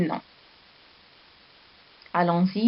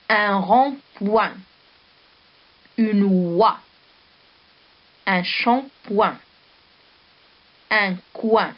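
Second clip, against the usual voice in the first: slow dictation; slower than usual. Shown as a demonstration. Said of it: "Je répète".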